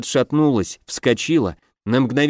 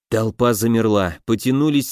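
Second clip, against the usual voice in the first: usual speaking voice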